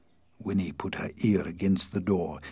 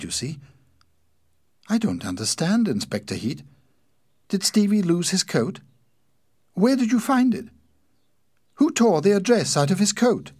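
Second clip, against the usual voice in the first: high, louder woman's voice climbing into questions; Winnie, puzzled and growing anxious; 8 semitones higher, 7 dB louder than usual. A narrator's reading. Sister asks inspector about her brother's coat, increasingly anxious.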